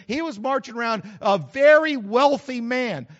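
loud rolling sermon delivery; preaching. Acted. Pastor preaching to his congregation, loud and rolling. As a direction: preach it out to the whole room.

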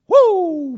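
high loud whoop of joy; joyful preacher's whoop; whooping cheer. Real, not acted. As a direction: let out a celebratory woo. Celebrating the miracle.

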